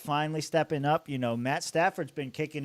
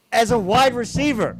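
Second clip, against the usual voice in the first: exasperated shout; 9 semitones higher, 10 dB louder than usual. Real, not acted. Ranting about the Titans.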